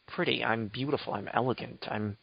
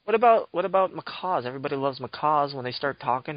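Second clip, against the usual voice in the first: ordinary talk, nothing marked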